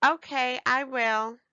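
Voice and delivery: put-on sweet child reply; eager little girl. A narrator's reading. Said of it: "Ebony said".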